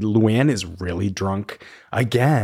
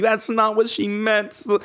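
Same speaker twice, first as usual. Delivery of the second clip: higher, hurried pleading; pleading Ramona; 9 semitones higher than usual. Retold or imitated. Ramona running after Luann insisting Sonia didn't mean it, in a higher voice. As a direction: chase after her explaining. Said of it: "Ramona's running around, being like".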